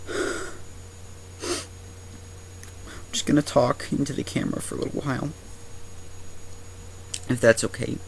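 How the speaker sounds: stunned, breathless fright; shaken. Acted. Right after the dynamite blows up.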